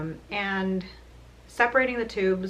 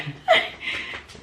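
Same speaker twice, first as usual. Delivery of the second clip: ticklish giggle. Real, not acted. Being tickled in bed.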